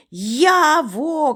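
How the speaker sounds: high, long holler; крик на повну губу. A narrator's reading. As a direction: call out as if at full voice. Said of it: "кричу я на повну губу". (високий поклик на повну губу)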